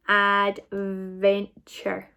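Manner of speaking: ADVENTURE stretched as ad, ven, ture; slow blend. Shown as a demonstration. Teacher blending the sounds back together, ADVENTURE slow and drawn out. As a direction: blend ADVENTURE very slowly, part by part. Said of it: "remembered all the sounds".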